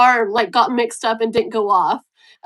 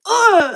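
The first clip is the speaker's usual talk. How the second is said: a high, loud exasperated ugh; annoyed groan; 8 semitones higher, 5 dB louder than usual. Retold or imitated. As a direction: groan like you just remembered the thing you forgot. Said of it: "I'm like".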